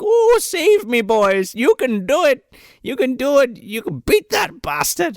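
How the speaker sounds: put-on chained old man pleading. Chained Henry Gibson cheering the boys on, high pleading squeak. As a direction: squeak out a helpless cheer from the backboard. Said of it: "He's like".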